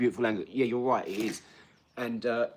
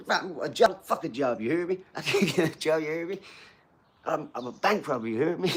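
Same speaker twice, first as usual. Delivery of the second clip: loud, higher mock American street talk; hammed-up New Orleans accent, half laughing; 5 semitones higher, 5 dB louder than usual. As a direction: imitate a cocky American through giggles. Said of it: "the two bloods from America".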